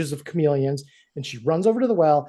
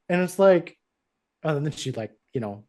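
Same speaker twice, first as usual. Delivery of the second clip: ordinary talk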